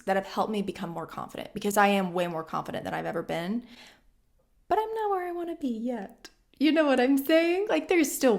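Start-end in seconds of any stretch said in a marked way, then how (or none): 4.7-8.4 higher, squeaky aside to the viewer. Real, not acted. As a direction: lift into a little self-mocking squeak. Self-talk about not being there yet.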